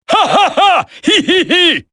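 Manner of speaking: high, giddy villain laugh; gleeful laughter. Acted. Villain laughing at the trapped soldiers, gleeful. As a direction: cackle with delight.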